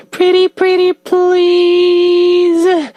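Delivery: high, drawn-out begging; hammed-up pleading. DJ voicing a listener begging for strength, hammed-up plea. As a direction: beg sweetly, stretching "please" way out.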